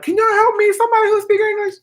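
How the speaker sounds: very high cry for help; hammed-up scream to any passer-by; mock scream. Lost traveller calling out for an English speaker, high mock scream. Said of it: "I would have screamed".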